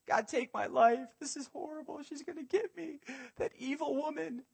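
high, pleading mock despair; hammed-up high wail of a scared Elijah; whiny panicked Elijah. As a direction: whine it like a frightened prophet. Elijah voiced whining, afraid.

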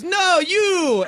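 put-on high Jamaican-accented insistence. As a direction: point him out in a Jamaican accent. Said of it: "this crazy Jamaican accent".

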